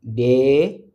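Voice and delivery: each sound drawn out; slow spelling. Shown as a demonstration. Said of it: "c'est di".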